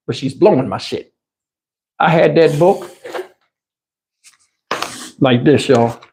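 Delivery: normal talking voice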